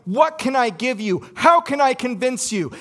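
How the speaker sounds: a hammed-up plea for mercy; pleading prisoner. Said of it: "They start saying".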